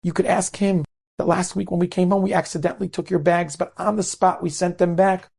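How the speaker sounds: high-pitched, pleading protest, no tears; building from pleading to indignant reproach; distraught. Retold or imitated. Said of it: "this mother is so distraught".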